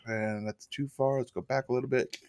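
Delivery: usual speaking voice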